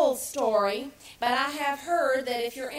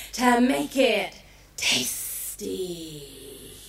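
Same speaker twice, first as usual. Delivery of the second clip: sly chameleon; 5 semitones lower, slower than usual. Acted. Chameleon offers advice to fox, low and slow. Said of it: "said the chameleon".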